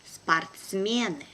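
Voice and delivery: slow and careful, drawn out for learners; teacher's careful slow reading. Shown as a demonstration. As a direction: spell it out slowly for the class. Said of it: "one first time will be slow".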